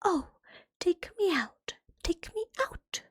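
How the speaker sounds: lightly voiced pleading bread. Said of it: "the bread cried out".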